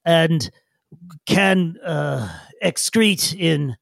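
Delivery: slow, formal creature groping for words; awkwardly precise little digger; halting digger. Acted. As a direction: pause between words, stiffly. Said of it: "in their sort of bags and says".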